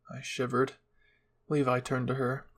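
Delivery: ordinary delivery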